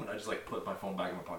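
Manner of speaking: ordinary talk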